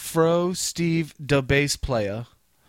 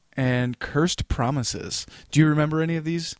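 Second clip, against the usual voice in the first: normal talking voice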